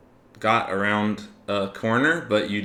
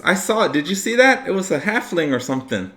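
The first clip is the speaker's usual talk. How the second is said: high, loud frantic chatter; excited shout; 11 semitones higher, 7 dB louder, faster than usual. Acted. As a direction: yell it out in a rush. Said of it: "he says".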